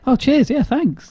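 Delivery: squeaky grateful voice; high mock thanks. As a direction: pitch it way up in thanks.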